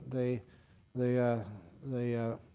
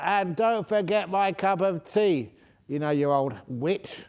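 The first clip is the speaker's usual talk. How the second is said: higher, louder, slower mock shout; shouted rude demand; 6 semitones higher, 5 dB louder, slower than usual. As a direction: shout it rudely down the cabin. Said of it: "he shouted out".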